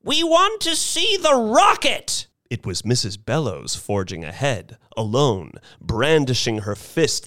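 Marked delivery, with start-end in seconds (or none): 0.0-2.3 put-on very high, strident old-lady outcry; indignant demand. A narrator's reading. Old woman forging ahead to confront Mister Thurkle, demanding indignantly.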